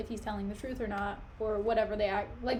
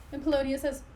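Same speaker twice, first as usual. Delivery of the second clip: ordinary delivery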